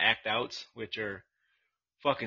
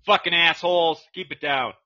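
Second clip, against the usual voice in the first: shouting; 6 semitones higher, 8 dB louder than usual. Real, not acted. Yelling at loud people nearby.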